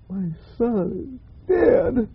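slow, soft, tearful cry of grief; weeping widow. Acted. Preacher voicing the grieving widow, slow and tearful. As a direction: let the grief break through, slow and quiet.

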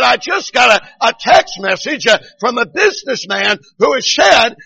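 high, loud revival delivery; preacher's retold shouting. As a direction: retell it as a fervent pulpit shout. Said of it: "He began to shout even more".

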